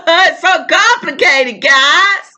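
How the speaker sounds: high, drawn-out emotional exclamation; laugh, then draw it out high, overwhelmed; real overwhelmed, high-pitched plea. Real, not acted. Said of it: "now I'm emotional".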